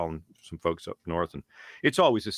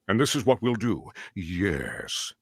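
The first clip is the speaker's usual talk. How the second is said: Megatron yes at end; slower than usual. Shown as a demonstration. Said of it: "But I switched it around".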